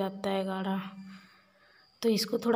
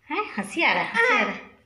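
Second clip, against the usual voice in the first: put-on baby talk; 5 semitones higher than usual. Cooing to baby.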